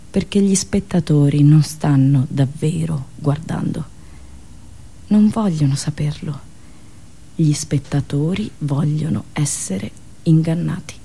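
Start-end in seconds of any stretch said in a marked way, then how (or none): none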